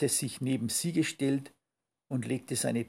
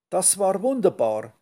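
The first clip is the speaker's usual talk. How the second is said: higher, louder happy exclamation; delighted little skunk, raised voice; glücklich seufzend; 6 semitones higher, 6 dB louder than usual. A narrator's reading. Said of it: "seufzte das kleine Stinktier glücklich". (glücklich schwärmend, hell und laut)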